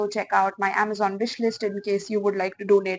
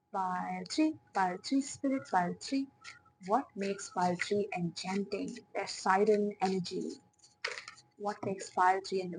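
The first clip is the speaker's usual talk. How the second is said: hushed channeling voice. Acted. Reader asking spirit about pile three, hushed and mystical.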